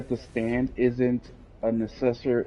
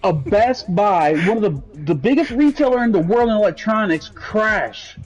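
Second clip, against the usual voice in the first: raised, heated complaining; heated rant; 5 semitones higher, 4 dB louder than usual. Real, not acted. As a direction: rant louder and higher, fed up. Ranting about Best Buy crashing.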